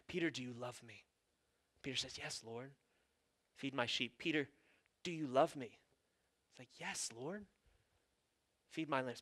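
hushed, tender. Retold or imitated. Jesus asking Peter about love, hushed and tender. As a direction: speak it gently, near a whisper.

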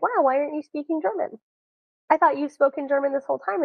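mock bafflement. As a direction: act out someone baffled by the obvious. Acting out baffled expectant listener.

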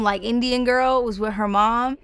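ordinary talk, nothing marked

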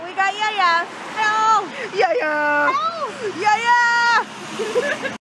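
high excited yelling; excited shouting. Pretend. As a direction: call out playfully, high and loud. Arriving, playful calling out.

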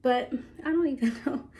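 normal talking voice

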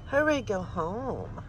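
ordinary talk

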